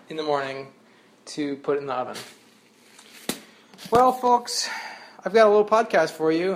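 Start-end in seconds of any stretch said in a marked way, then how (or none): 3.9-6.5 mock announcer. Mock grand podcast intro.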